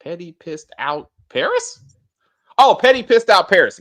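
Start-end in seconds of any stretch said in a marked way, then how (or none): 2.6-3.8 higher, louder sudden understanding; oh, now I get it; realization. Real, not acted. Host suddenly understanding a viewer comment, surprised.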